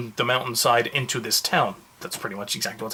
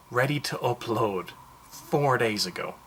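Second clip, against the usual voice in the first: ordinary talk, nothing marked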